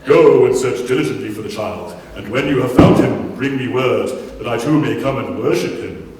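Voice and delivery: loud, booming pantomime villain; evil villain panto voice. Pretend. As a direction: ham it up like a panto baddie. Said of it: "an evil villain panto voice".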